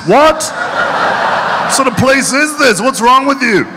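high, drawn-out mock disbelief; incredulous. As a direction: question them in high-pitched, appalled bafflement. Acted bewilderment at drinking piss.

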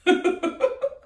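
high little giggle; real amused titter; short giggle. Real, not acted. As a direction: giggle at your own joke. Laughs at own French accent.

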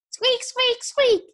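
very high squeaky creature cries; shouted squeaks. A narrator's reading. Clay plays an annoying scavenger, shouted squeaks. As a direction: squeak like an annoying little pest. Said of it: "he shouted".

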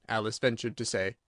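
plain reading voice

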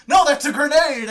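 high, loud protest in a retold quarrel; acted indignant yell; mock arguing. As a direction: shout the correction as if arguing. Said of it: "argue with the orcs".

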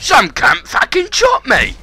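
high angry yell at being shot; furious shout. Real, not acted. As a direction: roar it out in outrage. Angry gamer shot in game.

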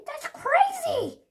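high, loud, slow outraged cry; shouted. Acted. Papa denies being the thief, shouting high.

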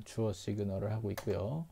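ordinary talk, nothing marked

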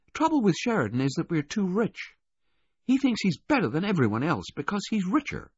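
put-on high-pitched, opinionated rich girl; confiding adolescent girl. Girl telling waiter about her brother, confiding and critical. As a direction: air a grievance in a high young voice.

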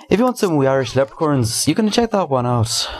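put-on playful Irish accent. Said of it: "that's the end of my Irish accent".

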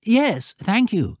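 put-on high, courteous boy's voice; well-mannered lad answering politely; polite schoolboy. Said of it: "with native politeness".

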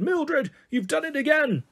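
high, scolding teacher voice; a nightmare headmistress catching her out; stern headmistress scolding. Acted. Miss Cackle scolds in nightmare.

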